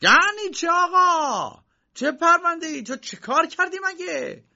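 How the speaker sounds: very high, loud, slow outburst; uncle shouting. Acted. Uncle bursting out at Josef about the case, very loud and high. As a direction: explode at him. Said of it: "داد عمویش درآمد".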